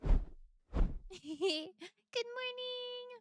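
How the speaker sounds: high stretched-out morning; high sing-song greeting. Acted.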